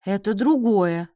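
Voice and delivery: loud, insistent objection; protesting. A narrator's reading. Father disputing his daughter's comparison, protesting. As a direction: object firmly and at once. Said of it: "запротестовал Сергей".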